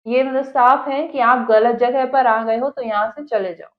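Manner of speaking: ordinary talk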